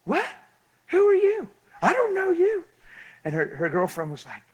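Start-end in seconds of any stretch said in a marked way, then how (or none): 0.0-2.7 very high, loud mock girl voice; startled girl. Preacher imitating girl confronted by stranger, startled. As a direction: squeal it as a thrown stranger.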